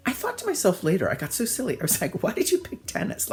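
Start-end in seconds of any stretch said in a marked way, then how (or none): 2.2-3.3 much higher, breathy disbelief at himself; self-mocking. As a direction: squeak at your own silly choice. Scolding himself in memory.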